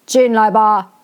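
响亮威严的大王嗓音; 隔着门威严地发话. A narrator's reading. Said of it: "一个很威严的声音". (威严的声音)

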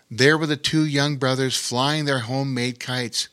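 plain reading voice

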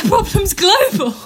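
high, loud, laughing echo; laughing disbelief. Retold or imitated. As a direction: repeat it back, laughing in disbelief. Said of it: "And I was like".

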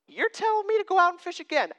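high-pitched, put-upon question; incredulous protest. Acted. Tired fisherman Peter to Jesus after fishing all night, incredulous. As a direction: question the order in acted disbelief, pitched high.

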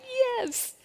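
very high, soft, choked-up yes; a yes caught in happy tears; tearful yes. Retold or imitated. Overwhelmed by the Sears lady's kindness, tearful high yes. Answering the Sears lady, moved.

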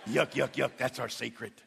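low, soft mock chuckle into a hushed aside; smug chuckling. Men sharing a secret with pastor, smug and hushed. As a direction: imitate a smug conspirator, trailing very quiet. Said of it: "that little smug look and say".

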